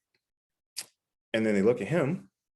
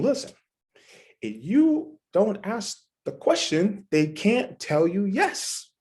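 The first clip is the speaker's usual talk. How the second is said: slow firm lesson; 7 semitones higher, slower than usual. Retold or imitated. Coaching the trainee.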